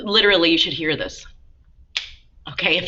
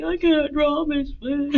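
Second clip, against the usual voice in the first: put-on high, whining little kid voice; whiny child complaining to the teacher; 9 semitones higher than usual. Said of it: "the kids that are like".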